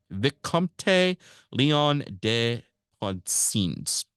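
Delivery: slow, careful stab at "Vicomte Leon de Poncins" in an English mouth; halting attempt at a French name; unsure French name. Real, not acted. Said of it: "Probably butchering that".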